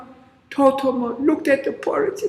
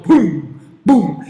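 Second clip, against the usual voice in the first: booming footsteps; 4 semitones lower, 6 dB louder than usual. Acted.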